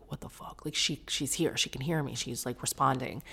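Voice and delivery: hushed awestruck whisper; stunned whisper. Retold or imitated. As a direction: breathe it in disbelief. Friend's stunned reaction retold.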